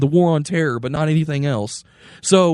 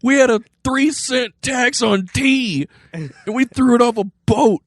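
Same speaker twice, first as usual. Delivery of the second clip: high-pitched comic indignation, punched out; hammed-up disbelief, pitched way up; mock-outraged founding father; 9 semitones higher, 4 dB louder than usual. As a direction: protest like an appalled founding father. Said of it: "Right after Jefferson died, they would have been like".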